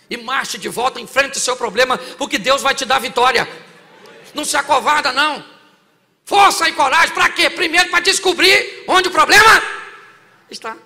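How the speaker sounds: full-force sermon climax; fiery preaching. Acted. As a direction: build it into a shout to the church.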